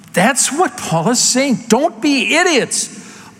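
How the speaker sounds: fired-up preacher scolding the congregation; worked up. Real, not acted. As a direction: hammer the warning home, heated. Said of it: "I'm getting a little worked up".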